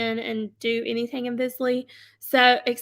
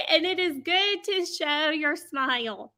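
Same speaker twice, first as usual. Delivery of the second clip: usual speaking voice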